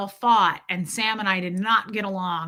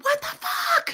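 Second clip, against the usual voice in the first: high shrieked outburst, far above her own voice; mom's shocked shriek at the wheel; retold scream. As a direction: shriek it like a panicked mom. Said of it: "my mom's screaming".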